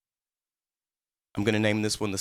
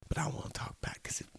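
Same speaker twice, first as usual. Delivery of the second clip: whisper; 3 semitones higher, 12 dB quieter, much faster than usual. Real, not acted. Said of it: "ruined the surprise".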